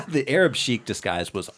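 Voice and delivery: ordinary talk, nothing marked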